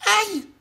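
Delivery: very high, loud yelp of pain; ouch. Acted. Tiny man falls, high loud ouch. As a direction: yelp as he hits the ground.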